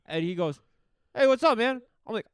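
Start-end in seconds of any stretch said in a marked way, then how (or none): 1.1-1.8 higher, louder casual greeting; friendly hey from a passerby; stranger's greeting. Retold or imitated.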